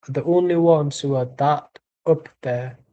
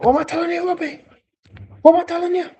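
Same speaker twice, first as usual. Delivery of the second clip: high-pitched mock Jamaican accent; yard man impression; faster than usual. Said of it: "That's my yard man impression".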